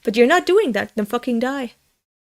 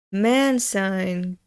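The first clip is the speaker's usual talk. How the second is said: normal talking voice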